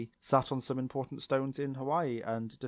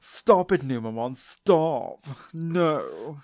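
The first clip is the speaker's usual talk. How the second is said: loud, higher-pitched prim mock plea; Hermione Granger; 4 semitones higher, 7 dB louder, much slower than usual. Girl telling Numamon to stop, loud Hermione-like impression. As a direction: beg in a schoolgirl swot's voice, punching each stop. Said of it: "Why is she Hermione Granger now?".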